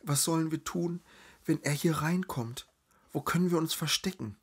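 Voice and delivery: high-pitched, panicky hushed questions; frightened whispering. A narrator's reading. Trapped woman asks her friend where to hide, panicked. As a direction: fret in a high voice, quickening at the end. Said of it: "flüsterte Lazaralen".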